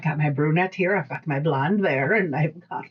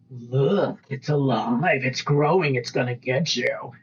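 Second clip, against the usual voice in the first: mock-menacing older sibling; lightly hammed-up monster warning; teasing spooky sibling; 3 semitones lower, slower than usual. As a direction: scare a little kid playfully. Imagined older sibling scaring younger child.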